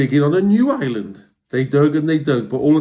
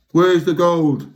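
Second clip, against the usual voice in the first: louder, slow demanding pirate shout; 4 semitones higher, 5 dB louder, slower than usual. Acted. Hank Chief demanding the gold, loud. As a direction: bark the question at the troll. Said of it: "shouted Hank Chief".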